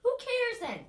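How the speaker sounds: exasperated. Acted. Urging friend.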